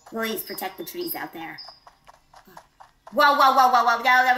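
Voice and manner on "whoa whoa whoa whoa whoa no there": loud, rapid string of whoas; jumpy, loud reaction to a bug; startled alarm. Real, not acted. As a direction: blurt it out in sudden alarm.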